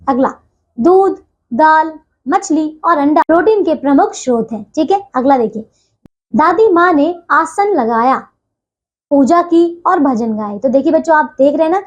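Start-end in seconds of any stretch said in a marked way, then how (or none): 0.8-4.7 दूध, दाल each set apart by a pause; pauses at commas. Shown as a demonstration. Teacher reads a list example, pausing after दूध and dal. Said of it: "थोड़े समय के लिए बीच में रुक रहे हैं". 6.4-10.6 slow reading with a clear pause after लगाया; pause at comma. Shown as a demonstration. Teacher reads a comma example, slow with pause after लगाया. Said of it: "थोड़े समय के लिए बीच में रुक रहे हैं".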